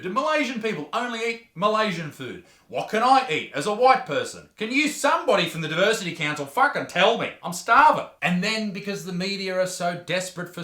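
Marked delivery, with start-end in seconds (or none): 0.0-8.1 rising, louder comic rant; mock outrage. Host ranting at the Diversity Council, mock outraged. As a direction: demand it like a starving victim.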